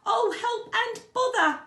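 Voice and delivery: high, loud, slow wail; exasperated distress. A narrator's reading. Pooh stuck fast in the hole, distressed. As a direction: draw it out high and loud in dismay. Pooh's despair at being stuck.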